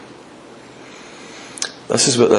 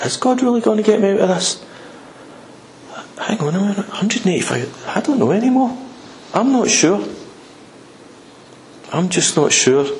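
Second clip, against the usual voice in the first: higher, hesitant worry; wavering doubt; 6 semitones higher than usual. Acted. Believer questioned by the enemy, wavering doubt. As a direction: lose your nerve bit by bit, halting. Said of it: "He opens the door to doubt".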